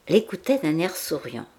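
ordinary delivery, nothing marked

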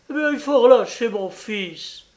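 high, loud, urgent demand; mother pressing the judge. A narrator's reading. Mother demands her son's release.

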